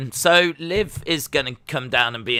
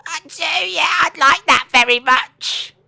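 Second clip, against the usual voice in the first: high, squawky mock voice; silly imagined groom voice; 13 semitones higher, 7 dB louder, slower than usual. Bridegroom answering his vows, silly high voice. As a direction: squeal it far above your own pitch. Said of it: "his voice is like".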